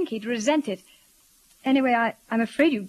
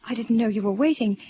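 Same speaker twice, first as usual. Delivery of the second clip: usual speaking voice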